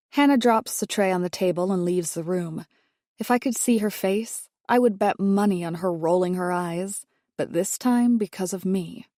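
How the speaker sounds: ordinary delivery, nothing marked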